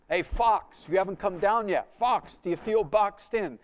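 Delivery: loud, high mocking jeer; jeering taunt. Party friends jeer at a tripping friend, loud mocking. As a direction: ridicule him loudly.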